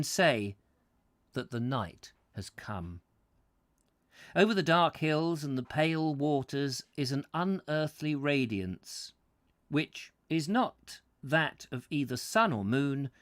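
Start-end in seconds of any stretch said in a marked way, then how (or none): none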